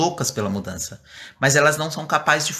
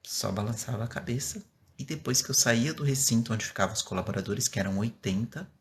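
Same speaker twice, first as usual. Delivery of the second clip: soft voice; 3 semitones lower, 7 dB quieter than usual. Shown as a demonstration. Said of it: "Eu comecei falando baixo".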